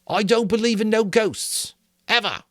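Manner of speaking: high, indignant schoolboy. A narrator's reading. Piggy to the assembly, indignant and high. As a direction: huff it out, offended. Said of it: "His voice was indignant".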